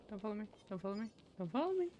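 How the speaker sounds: normal talking voice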